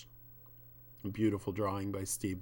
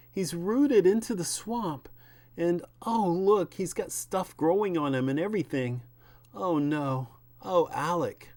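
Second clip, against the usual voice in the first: put-on raised, distressed young woman finding her friend overgrown; higher and louder, horrified at what she sees; Abby's alarmed voice; 9 semitones higher, 4 dB louder than usual. Wife cries out to husband about Alec, alarmed. Abby shocked at rooted Alec.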